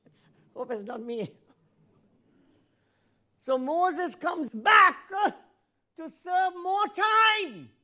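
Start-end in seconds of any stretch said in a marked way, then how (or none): 3.4-7.7 slow, loud and higher, drawn out; booming preacher. Real, not acted. Preacher driving the point home, slow loud booming. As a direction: shout it from the pulpit, every word stretched.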